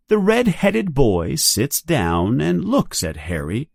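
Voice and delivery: ordinary delivery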